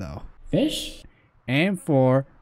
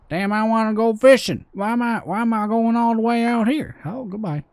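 raised-pitch southern twang, hammed up; southern accent; 7 semitones higher than usual. Gamer playing a fisherman heading out, southern accent. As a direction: put on a big southern accent. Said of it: "I'm gonna keep the southern accent".

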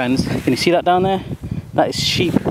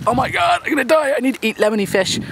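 high-pitched hammed-up panic; squeaky, exaggerated last-resort plea; mock desperation; 9 semitones higher than usual. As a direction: play a starving survivor in mock despair.

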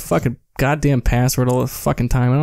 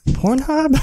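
mock innocent question; 11 semitones higher than usual. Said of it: "and I'm like".

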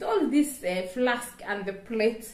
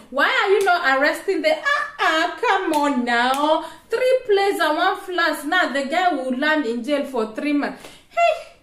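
raised, heated protest with amused edge; indignant rant; 4 semitones higher, 5 dB louder than usual. Real, not acted. Vlogger telling authorities to arrest big thieves, indignant. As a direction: scold them loudly, pitch climbing.

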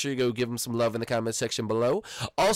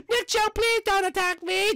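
squeaky high begging voice; high pleading squeal; 5 dB louder than usual. Shown as a demonstration. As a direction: whine it like a scared little guy. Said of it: "let's say that this man".

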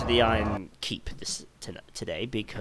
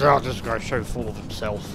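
mocking critic. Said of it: "everyone goes".

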